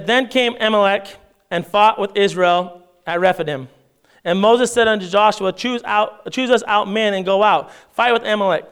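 scripture reading. Acted. Pastor reads Exodus aloud to the church, measured reading voice. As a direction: read the King James text to the congregation.

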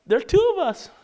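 high-pitched mock protest; doubting servant. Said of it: "I'm sure the servant's like".

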